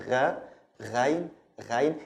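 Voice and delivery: GH made like French R; "gha" from the back of the tongue; French-R "ghain". Shown as a demonstration. Teacher demonstrating the letter, French-R GH. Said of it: "pronounced like the French R".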